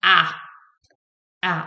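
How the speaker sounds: आ as the short English "a" in "apple"; short A sound. Shown as a demonstration. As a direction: open आ, one isolated phonics sound. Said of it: "short sound होती है ए की".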